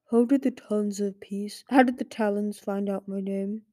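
a tired, wary father dragon asking; a hint of an ancient stone-bound dragon; grave old dragon. Petrified dragon father speaking.

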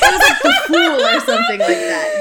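high, loud laughing outburst; laughing shout. Real, not acted. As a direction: yell it through laughter.

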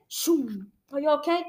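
usual speaking voice

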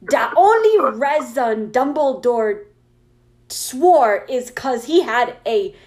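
slow, emphatic, indignant author's rant; character voice. Acted. Author scolding flamers in a note, slow indignant character voice. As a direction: shout the opening, then punch each misspelled word. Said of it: "read it in my character voice".

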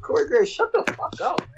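exasperated. Retold or imitated. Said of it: "and I'm like".

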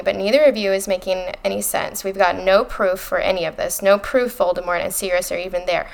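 ordinary talk, nothing marked

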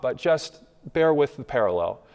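ordinary delivery

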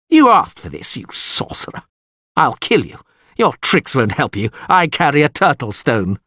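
high-pitched, heated menace; angry threat. A narrator's reading. Lord draws his sword on the sorcerer, angrily threatening. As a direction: burst out as if attacking.

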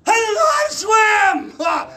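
very high, loud mock panic; panicked yell. Non-swimmer falling into a river, hammed-up panicked yell. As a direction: scream it out in hammed-up terror.

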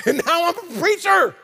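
high laughing delight at the irony. Real, not acted. Preacher laughing at himself to the congregation, amused disbelief. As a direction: laugh it out, amazed at yourself.

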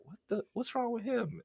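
high, soft indignant question; imitated bafflement. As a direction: ask it high and small. Offended girl's question, imitated.

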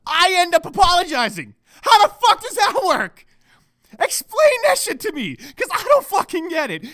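loud, high-pitched exasperated outburst; exasperated rant. Real, not acted. Man ranting about losing arguments with his girl, exasperated. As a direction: shout it in real frustration.

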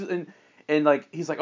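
usual speaking voice

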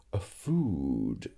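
whispered reading. Acted. Tourist reading from phrasebook.

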